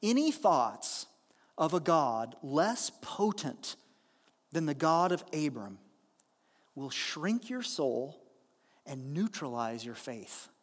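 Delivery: measured scripture-style reading; slow weighty quote read aloud; slow solemn reading. Shown as a demonstration. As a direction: read it slowly and gravely, letting it land. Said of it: "Let me read that again".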